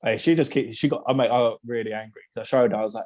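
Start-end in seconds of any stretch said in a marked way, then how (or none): none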